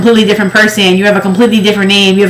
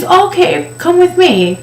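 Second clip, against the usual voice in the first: much higher, sweet mock saleslady; 10 semitones higher, slower than usual. Clerk guiding the shopper, high sweet mock clerk. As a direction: play the chirpy store clerk.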